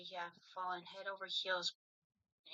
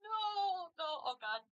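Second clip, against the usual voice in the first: distressed cry; 12 semitones higher, 4 dB louder than usual. Acted. Crying out in distress.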